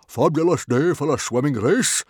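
big, loud, high hearty roar; roaring hearty Stoic. A narrator's reading. Stoic roaring happily to his son on the beach. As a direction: boom it out like a jolly chieftain. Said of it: "he roared happily".

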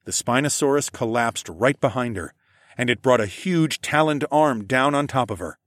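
plain reading voice

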